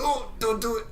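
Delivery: a broken-backed man begging not to be lifted, mock pain; pained groan. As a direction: beg them not to move you. Injured man being lifted.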